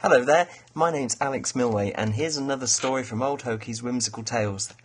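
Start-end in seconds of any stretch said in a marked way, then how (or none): none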